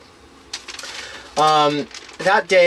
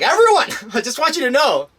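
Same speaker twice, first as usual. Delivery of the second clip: high-pitched address to everyone; mock announcement; 10 semitones higher than usual. Imagined announcement to everyone on the bus, high and laughing. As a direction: call out high to a crowd, breaking into a laugh. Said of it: "stand up and just be like".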